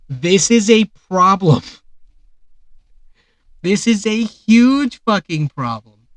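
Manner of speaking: slow, loud, drawn-out outrage; emphatic slow rant; exasperated rant. Real, not acted. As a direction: lean hard on every word in disbelief. Ranting about coach salaries.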